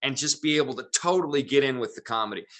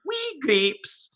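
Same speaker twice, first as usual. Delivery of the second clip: put-on Scottish accent on a much higher punchline. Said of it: "I pretended to be from Scotland".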